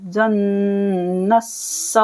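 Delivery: ज़न्नस्सौ with a hummed doubled nun; slow recitation with ghunna; nasal ghunna. Shown as a demonstration. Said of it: "नून पर गुन्ना करेंगे".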